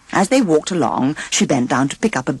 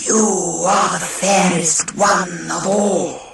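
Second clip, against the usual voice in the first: mirror voice; slower than usual. A narrator's reading.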